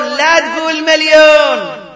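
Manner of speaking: loud, high, slow "لا تقول مليون"; forbidding cry from the pulpit; emphatic shout. Real, not acted. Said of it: "repeats the phrase for emphasis".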